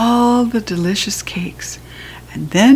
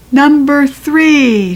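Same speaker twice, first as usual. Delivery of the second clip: slow, louder, higher call; hollered number; 6 semitones higher, 5 dB louder, much slower than usual. A narrator's reading. Cake lady hollering the winning number. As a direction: stretch the number out. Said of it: "she hollered out".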